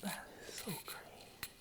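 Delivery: breathless whisper after laughing; whispered disbelief. Real, not acted. After laughing, whispering in disbelief. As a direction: whisper it, stunned.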